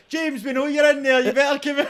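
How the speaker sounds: mock police call-out. Welsh police outside calling James to come in, mock shout. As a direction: call out to a hidden suspect, hammed-up.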